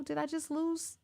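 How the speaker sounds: ordinary talk, nothing marked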